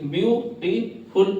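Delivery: drawn out slowly. Shown as a demonstration. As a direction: draw the word out like dictation for a child. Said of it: "तो आप उसके टुकड़े कर दीजिए".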